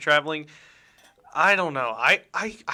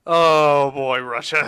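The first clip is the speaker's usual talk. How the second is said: mock Russian accent; 9 dB louder, slower than usual. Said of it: "You need to stop with your Russian accent".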